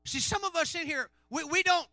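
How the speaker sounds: loud, high, driving sermon; full-force preaching. Acted. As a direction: preach it out to fill the room.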